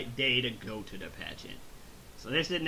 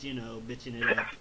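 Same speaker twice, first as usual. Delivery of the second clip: ordinary talk, nothing marked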